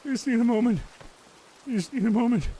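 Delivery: winded and hurting; just hit in the groin. Acted. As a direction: gasp out the words in pain. Winded, repeating need a moment.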